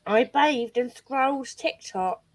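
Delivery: slow, lightly put-on imitation of a friend's accent; imitating Smash's accent. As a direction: drag the words out in the friend's accent. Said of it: "That's not how Smash talks".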